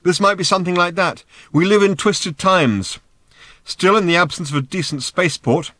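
loud, high, talking over thundering hooves; shouted explanation. A narrator's reading. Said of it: "shouted Ford".

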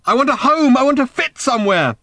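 high, loud desperate scream; anguished shout. A narrator's reading. Random wanting a home, shouting in anguish. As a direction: scream the longing at full pitch. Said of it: "shouted Random".

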